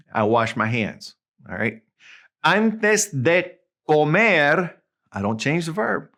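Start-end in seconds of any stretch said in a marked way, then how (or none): 2.4-4.7 very slow, higher-pitched teaching demo; slow, word-by-word Spanish. Shown as a demonstration. Teacher showing the unchanged verb, slow and deliberate. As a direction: stretch out each word for learners.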